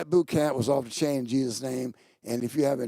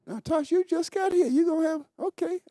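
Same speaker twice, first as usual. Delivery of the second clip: playful teasing. Real, not acted. Teasing newly arrived Tosh.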